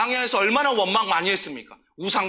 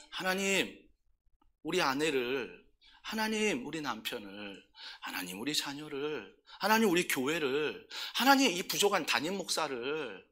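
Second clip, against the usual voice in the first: much softer, pleading prayer voice; 5 semitones lower, 9 dB quieter than usual. Shown as a demonstration. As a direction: pray quietly, handing everyone over to God. Demonstrates entrusting prayer to God.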